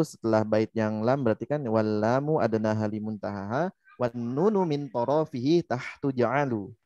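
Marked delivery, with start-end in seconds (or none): none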